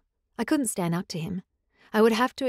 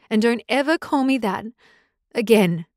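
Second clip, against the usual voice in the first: louder, cutting warning; furious, rising to a shout; angry demand; 2 semitones higher, 7 dB louder than usual. Acted. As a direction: bite off the last word.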